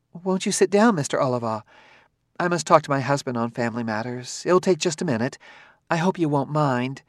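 a polite, lightly timid woman's voice; timid wife. A narrator's reading. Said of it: "then looked timidly at our Daniil".

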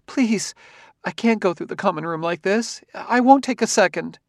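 high-pitched woman's plea; pleading wife. A narrator's reading. Wife begging to tidy up before going out, pleading. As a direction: beg for just a moment, high.

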